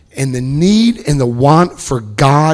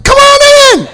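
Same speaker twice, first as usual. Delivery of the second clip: much higher, much louder gushing welcome; overeager welcome; 10 dB louder, slower than usual. Retold or imitated. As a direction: throw the door open, thrilled. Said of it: "I'm like".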